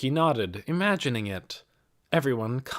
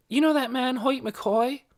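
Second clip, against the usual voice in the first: a hint of a girl's higher voice; lightly voiced young girl; 14 semitones higher than usual. A narrator's reading. Nicky answers Mrs. Beeson, lightly voiced as a girl. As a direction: lightly voice Nicky, eager and polite.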